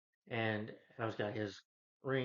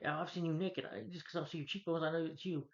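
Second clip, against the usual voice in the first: higher-pitched lightly voiced woman; imitating Carol; 6 semitones higher than usual. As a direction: lightly mimic a woman who sees through it, pitched up. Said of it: "But she's like".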